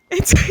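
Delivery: high burst of real laughter; high squealing laugh; loud laugh. Real, not acted. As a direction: break into loud delighted laughs. Laughing at hug answer.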